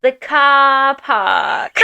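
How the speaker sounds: very slow, high, loud drawn-out British car park; mocking Ginny's British, dragging out car park; dragged-out British. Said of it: "Why you gotta drag it out".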